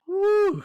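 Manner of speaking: a sudden high, loud woo; excited whoop. Real, not acted. Telling his friend how Coco got him, a loud high whoop. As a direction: let out a big whoop. Overwhelmed recalling the movie Coco.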